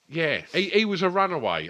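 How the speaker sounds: ordinary talk, nothing marked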